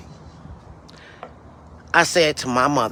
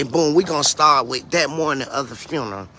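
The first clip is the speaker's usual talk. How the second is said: usual speaking voice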